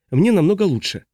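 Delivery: high, joyful call back. A narrator's reading. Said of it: "радостно отозвалась Сандра". (радостный высокий отклик)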